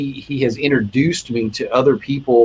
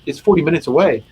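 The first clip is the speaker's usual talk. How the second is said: ordinary talk, nothing marked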